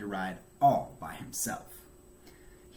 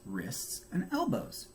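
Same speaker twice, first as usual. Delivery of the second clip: plain reading voice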